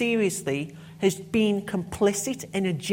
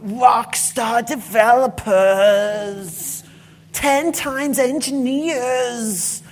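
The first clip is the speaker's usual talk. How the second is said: mock hype; 4 semitones higher, 9 dB louder, much slower than usual. Mocking hyped industry labels.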